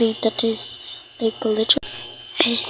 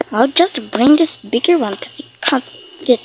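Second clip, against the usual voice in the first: ordinary delivery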